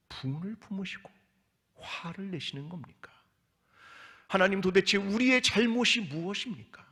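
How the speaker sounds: pleas rising, then dropping off; anguished questioning. Acted. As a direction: ask God if he is powerless, pleading. Voices the people's question. (무력한 하나님을 향한 애타는 질문)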